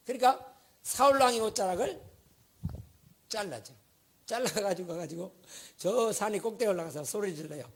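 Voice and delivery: normal talking voice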